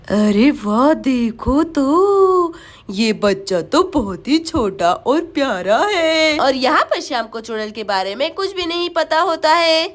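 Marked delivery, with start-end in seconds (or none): 0.1-6.4 low, very slow witch voice; gloating witch. Acted. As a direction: savour the sight like a hungry witch. Witch admires the child as prey. (चुड़ैल की धीमी, ललचाती आवाज़)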